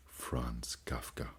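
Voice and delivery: unvoiced, slow whispered signature. Reading the letter's signature, slow whisper. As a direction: breathe the name out slowly.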